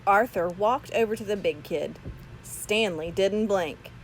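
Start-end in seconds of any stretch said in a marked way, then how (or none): none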